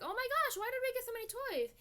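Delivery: put-on high, loud squeal of a little girl; amazed cartoon girl; excited girl voice. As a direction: squeal it like a thrilled little kid. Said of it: "Powerpuff Girls were like".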